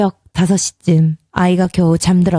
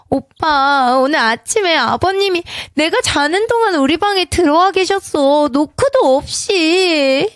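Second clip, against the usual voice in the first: shaky voice; 9 semitones higher than usual. A narrator's reading. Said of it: "나는 떨리는 목소리로 말했다".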